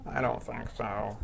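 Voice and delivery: put-on slow, quiet old man croak. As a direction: play it like a creaky old man. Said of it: "Is that your old man voice".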